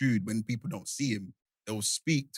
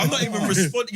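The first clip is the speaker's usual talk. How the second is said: laughing through the words, high and loud; 9 semitones higher, 7 dB louder than usual. Real, not acted. Laughing at the fuss over his comment. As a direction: bubble over with laughter as you say it.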